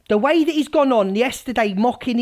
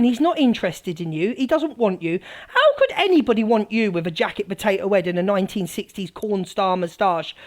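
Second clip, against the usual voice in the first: usual speaking voice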